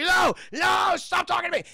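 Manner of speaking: very high, loud frantic scream; acted hysterical plea. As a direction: scream the voices away. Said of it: "he's just screaming".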